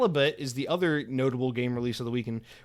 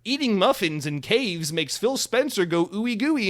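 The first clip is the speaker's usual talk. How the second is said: higher, slow mock-dreamy reading; silly title voice; 8 semitones higher, 3 dB louder, slower than usual. Podcaster reading out a nonsense title, slow higher mock voice. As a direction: savor the absurd title.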